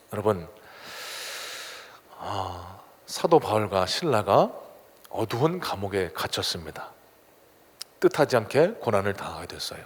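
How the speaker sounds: ordinary talk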